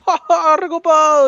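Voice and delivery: high, mocking goddess voice; breezy, uncaring goddess. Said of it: "dice la diosa ahí".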